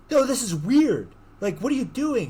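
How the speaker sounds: high, insistent acted objection; appalled protest. He confronts her over the memorial, acted protest. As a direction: object sharply with pitch way up.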